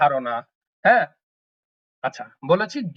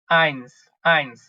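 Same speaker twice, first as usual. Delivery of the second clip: আইন্স drawn out slowly, both times falling; slow, careful model of আইন্স; slow model eins. Shown as a demonstration. Teacher slowly models eins for the class.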